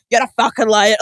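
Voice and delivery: mild mock shouting in own voice; mock angry shout. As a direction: bark the accusation again. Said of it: "different accents shouting angrily".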